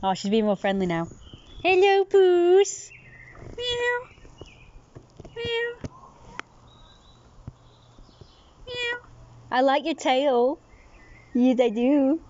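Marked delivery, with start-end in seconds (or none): 1.6-2.9 much higher, louder cooing. Real, not acted. Owner coos high at the friendly cat. 3.6-9.0 high imitated meow; cat meow. Owner meows high back to the cat.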